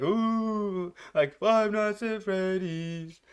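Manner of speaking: slow, high ghostly moan; eerie drawn-out phantom call. Pretend. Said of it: "Like a phantom is kinda trying to speak to you".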